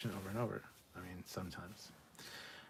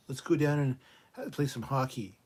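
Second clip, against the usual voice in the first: hammed-up Canadian accent, louder and higher; mock Canadian; 4 semitones higher, 8 dB louder than usual. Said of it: "how would you do a Canadian".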